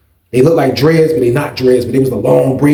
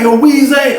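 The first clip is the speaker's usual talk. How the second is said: high, insistent hail; calling out; 10 semitones higher, 3 dB louder than usual. Retold or imitated. Angry client summoning the teller again, insistent call. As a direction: sing out the name to get attention.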